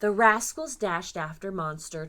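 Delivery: ordinary delivery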